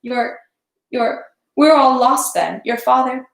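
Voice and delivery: high, slow, breaking plea; an old servant sobbing before his prince; bursting into sobs. A narrator's reading. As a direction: let the words break on a sob. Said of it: "burst into sobs".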